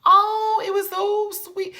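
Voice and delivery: high, gushing delight. Real, not acted. Vlogger gushing over Nina's mom's message, high gushing delight. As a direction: squeal how sweet it was.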